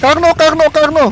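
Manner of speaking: high panicked screaming; being chased. Real, not acted. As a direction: scream the name in real panic. Dinosaur attacks, players panic.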